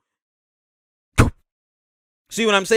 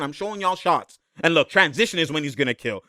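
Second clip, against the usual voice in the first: ordinary talk, nothing marked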